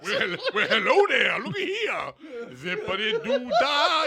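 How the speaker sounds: high, loud, teasing flirt; hammy flirty voice. Pretend. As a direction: oversell a cheesy come-on. Hammy seductive voice.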